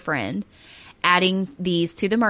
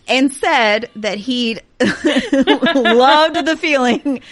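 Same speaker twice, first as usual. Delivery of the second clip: breaking into laughter mid-sentence; laughing; 5 semitones higher, 7 dB louder, much slower than usual. Real, not acted. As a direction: laugh through the words slowly. Laughing through grim detail.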